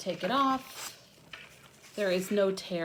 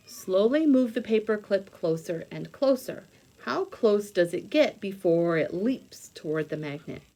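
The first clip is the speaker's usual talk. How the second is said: louder, bright read-aloud voice from a science book; 6 dB louder than usual. Acted. Reading a science book aloud to young viewers, louder storybook read.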